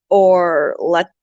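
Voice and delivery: ordinary talk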